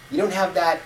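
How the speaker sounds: usual speaking voice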